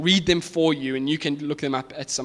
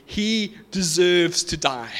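slow, weighty condemnation; crowd's cried verdict; 2 semitones higher, slower than usual. Retold or imitated. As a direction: pronounce the sentence slowly, each word pressed. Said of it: "they cried".

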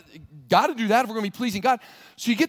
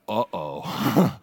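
low groaning "uh-oh" of dismay; 12 semitones lower than usual. Acted. Pilgrim realizing the answer is useless, dismayed. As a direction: sink into a worried uh-oh. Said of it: "And you're like".

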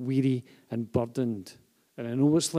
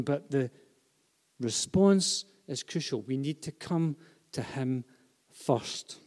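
ordinary delivery

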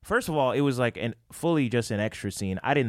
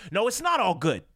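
Spike Lee scolding; 11 semitones higher than usual. Retold or imitated. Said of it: "And he goes".

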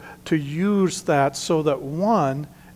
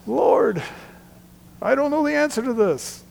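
pleading prayer; 10 semitones higher than usual. Pretend. Mock prayer before a test.

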